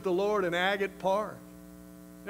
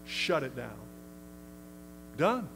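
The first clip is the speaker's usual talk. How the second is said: firm dismissal; 6 dB quieter than usual. Shown as a demonstration. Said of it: "You say".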